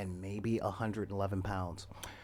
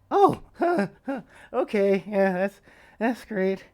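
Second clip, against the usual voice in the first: much higher and louder, laughing in relief; nervous laugh; 10 semitones higher, 9 dB louder than usual. Retold or imitated. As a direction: laugh through it, shaky with relief. Relieved after the bully's praise.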